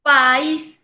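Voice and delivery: loud "País", stressed as pa-ÍS; stress on last syllable. Shown as a demonstration. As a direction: project "País" with the weight on ÍS. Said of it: "se carga también la voz en la última sílaba".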